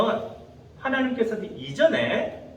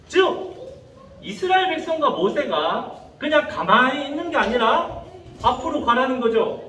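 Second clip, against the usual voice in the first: ordinary delivery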